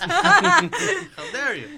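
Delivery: playfully scandalized; mock outrage. Cohost teasing her about the accent, mock offended and laughing.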